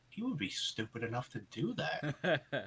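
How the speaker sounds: soft, slow mock inner voice; imitated private thought. Imagining what is in co-host's head, hushed and slow. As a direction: say it softly, as if thinking.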